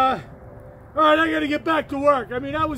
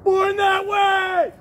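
laughing, slow, exultant shout of the words; joyful slow shout with a laugh; excited shout; 4 semitones higher, much slower than usual. Real, not acted. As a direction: belt it out with a laugh, drawn out. Gleeful repeat with a laugh.

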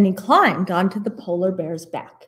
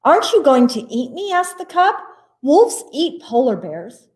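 quick, high and a little louder, scared cub; frightened cub; 6 semitones higher, 4 dB louder than usual. A narrator's reading. Cub asks the wolf, frightened. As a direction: blurt it out like a cowering cub.

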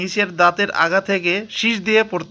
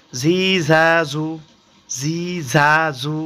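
ঝি ঝা ঝু said slowly, syllable by syllable; slow Arabic syllable drill; much slower than usual. Shown as a demonstration. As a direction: spell it out slowly for learners. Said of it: "বানান করে পড়ি".

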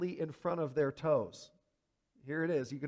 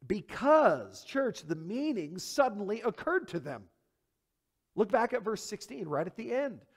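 ordinary talk

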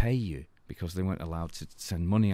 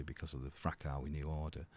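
usual speaking voice

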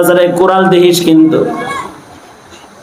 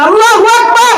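shouted takbir. Shown as a demonstration. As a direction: lead the crowd's shout. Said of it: "সবাই মিলে বলেন". (সবাইকে নিয়ে জোরে তাকবির)

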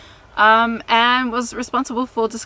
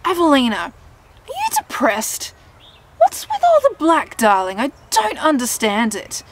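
put-on high, loud theatrical old nan; hammed-up nan, baffled and pitched way up; imitated nan; 6 semitones higher, 6 dB louder than usual. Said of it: "my nan ... Anyway, she's like".

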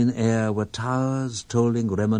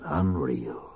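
unvoiced breathy whisper, lower; 6 semitones lower, 4 dB quieter than usual. A narrator's reading. Poem reader whispering the last city to listeners. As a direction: breathe the word out without voice.

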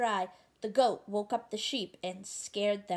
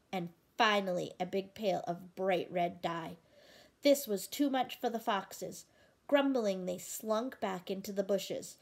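usual speaking voice

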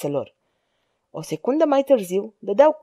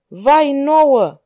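higher, louder cry of alarm; roaring cry; 5 semitones higher, 6 dB louder than usual. A narrator's reading. Lantene to his band as guards surround them, roaring. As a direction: roar it out in a raised voice. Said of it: "răgni Lantene".

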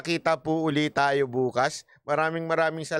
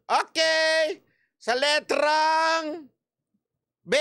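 very slow, drawn-out caller's announcement, pitched higher; bingo caller; 8 semitones higher, much slower than usual. Shown as a demonstration. Showing viewers how bingo is played, slow caller voice. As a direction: call the letter like a bingo host stretching it out. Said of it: "Ganito maglaro ng bingo".

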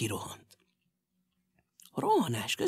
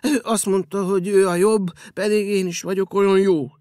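whimpering; 2 semitones higher, 7 dB louder than usual. Acted. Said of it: "szepegve felelt".

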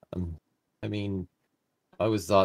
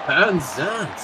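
excited echo; 8 semitones higher, 5 dB louder than usual. Real, not acted. Co-commentator echoes the goal call.

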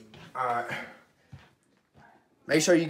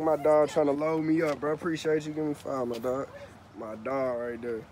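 ordinary talk, nothing marked

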